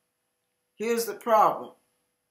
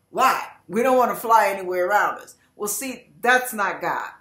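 ordinary delivery

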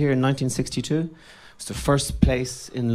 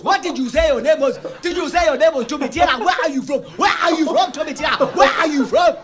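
put-on much higher, louder Zambian accent, rising to shouts; 11 semitones higher, 5 dB louder than usual. Boy mimicking Zambian townspeople asking his name, Zambian accent. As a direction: mimic Zambian locals quizzing a new kid. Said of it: "everybody was talking like this".

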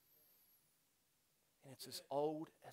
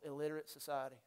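usual speaking voice

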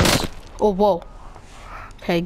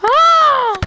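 startled yell; 8 dB louder than usual. Real, not acted. Sudden scream in game.

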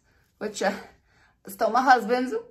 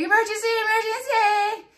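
panicked call; 8 semitones higher than usual. Retold or imitated. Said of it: "I'm, like, panicking".

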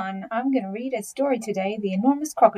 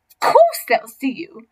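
loud, higher retort; not so big crocodile, scornful; 4 semitones higher, 9 dB louder than usual. Acted. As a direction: cut the boaster down.

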